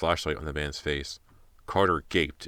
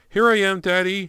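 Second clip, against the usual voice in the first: loud, high call to father; 8 dB louder than usual. Acted. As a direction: call across the house. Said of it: "she called".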